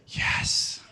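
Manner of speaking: imitating a small whispered yes. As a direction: whisper it like a scared kid. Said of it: "And so Jack says".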